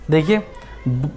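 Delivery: ordinary talk, nothing marked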